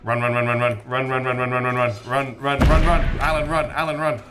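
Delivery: frantic rapid run chant; urging himself on while fleeing; being chased. Real, not acted. Fleeing enemies to exit.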